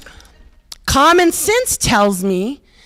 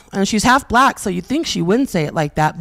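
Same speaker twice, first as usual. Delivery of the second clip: normal talking voice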